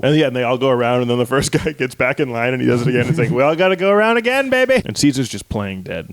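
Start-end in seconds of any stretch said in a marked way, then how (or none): 3.3-4.8 put-on rising, leering "baby"; hammed-up sleazy coaxing; high, sleazy sing-song. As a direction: coax them like a creep. Guy urges another round.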